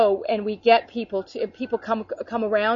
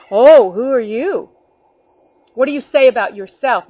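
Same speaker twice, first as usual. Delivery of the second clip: mock Pharisee challenge; 2 semitones higher, 9 dB louder than usual. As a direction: grill him like a suspicious Pharisee. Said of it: "what the Pharisees did".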